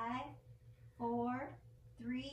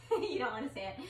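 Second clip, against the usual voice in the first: laughing; 2 semitones higher, 4 dB louder than usual. Real, not acted. Teasing child who won't moo.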